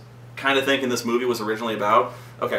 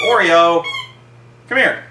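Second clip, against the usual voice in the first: raised, louder calling voice; calling a dog; 7 semitones higher, 6 dB louder than usual. Real, not acted. Host calling the dog over mid-recording, calling out. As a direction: call out to your dog, pitched up.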